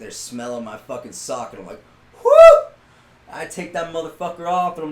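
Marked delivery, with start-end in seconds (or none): 2.2-2.7 high, loud "whoo" of disgust; sharp whoop at a foul smell. Retold or imitated. Storyteller re-enacts smelling his reeking sock, loud high whoop.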